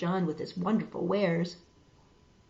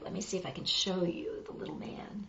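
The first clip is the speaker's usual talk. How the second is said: whisper; 9 dB quieter, faster than usual. Real, not acted. Reader whispering to children over the picture. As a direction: lean in and whisper the secret.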